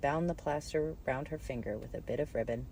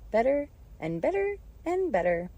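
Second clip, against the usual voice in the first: rising to squeak; 10 semitones higher, 6 dB louder than usual. A narrator's reading. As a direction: voice climbing higher with each repetition. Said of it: "Her voice went higher with each better".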